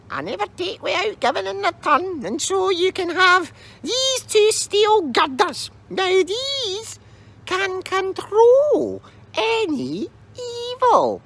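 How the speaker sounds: very high, cryptic crone voice; hint at magic slowly; mysterious old lady. Acted.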